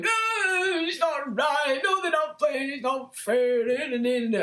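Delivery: high shouted whiny protest; hammed-up complaining; mock moaning.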